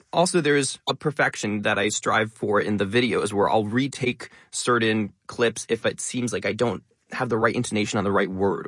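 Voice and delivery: ordinary talk, nothing marked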